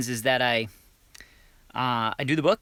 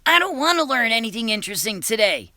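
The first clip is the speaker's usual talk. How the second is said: a pitched-up, whiny student; sulky schoolboy; 7 semitones higher than usual. Acted. Schoolboy Timmy refusing the lesson, whiny and high. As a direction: complain like a bored kid. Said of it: "Timmy raised his hand and said".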